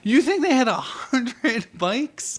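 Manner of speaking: high, slow, laughing disbelief; can't believe that guess; amused disbelief. Real, not acted. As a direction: laugh through the question in disbelief. Host amazed at the guess.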